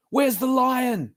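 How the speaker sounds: high, slow, dramatic demand; alarmed dad. Acted. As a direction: burst in ready to fight. Dad bursts in armed.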